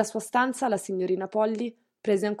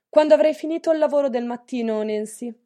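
louder, higher, brisk orders to the maid; commanding mistress; 4 semitones higher, 8 dB louder than usual. Acted. Strict mistress giving Nancy her morning orders, commanding. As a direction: bark out chores like a strict lady of the house.

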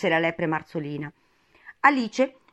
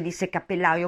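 ordinary delivery, nothing marked

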